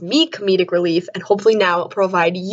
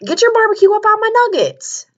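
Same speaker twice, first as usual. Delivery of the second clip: mock sass; 12 semitones higher than usual. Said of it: "And I was like".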